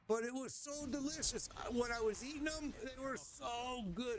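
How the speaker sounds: high, gushing about the taste; savoring delight. Real, not acted. Telling a friend the fish eyes tasted great, gushing. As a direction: relive how good they were. Recalling how tasty they were.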